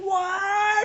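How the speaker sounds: very high-pitched, stretched "what"; acted high shriek of surprise; mock astonishment. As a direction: squeal it in hammed-up disbelief. Acts out reaction to guilty plea.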